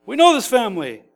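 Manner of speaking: high, mocking indignant crowd; incredulous sneer, pitched high; indignant scoffing. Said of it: "is what they're saying".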